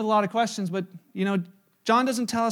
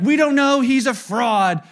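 loud dismissive crowd; 3 semitones higher, 8 dB louder, slower than usual. Retold or imitated. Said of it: "They're like".

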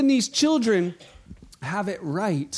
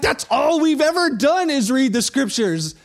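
louder, higher huffy protest; indignant critic; 4 semitones higher, 5 dB louder than usual. Retold or imitated. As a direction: blurt it out offended. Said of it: "I'm like".

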